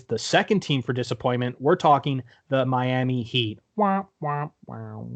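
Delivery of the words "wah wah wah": mocking wah wah; sad trombone wah.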